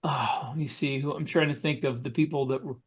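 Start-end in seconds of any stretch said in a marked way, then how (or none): none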